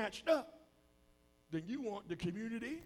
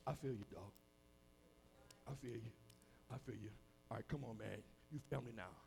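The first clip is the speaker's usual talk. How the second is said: low, soft, casual gang voice; street recruiter; 9 semitones lower, 10 dB quieter than usual. Acted. Street crew taking in a pushed-away son, low and easy. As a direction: draw him in quietly, man to man. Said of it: "they're gonna tell him".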